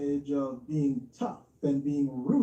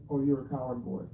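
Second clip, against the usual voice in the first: mocking timid imitation; 6 semitones lower, faster than usual. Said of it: "from screaming so loud and then".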